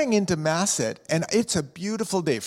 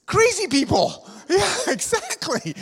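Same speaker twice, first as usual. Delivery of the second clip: joking; 11 semitones higher than usual. Real, not acted. Joking about storm chasers.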